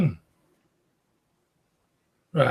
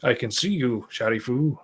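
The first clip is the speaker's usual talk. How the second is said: plain reading voice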